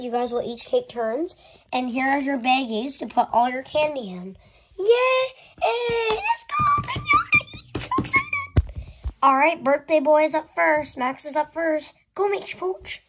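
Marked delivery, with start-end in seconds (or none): 4.8-8.4 squealing cheer. Acted. Guests cheer about the piñata.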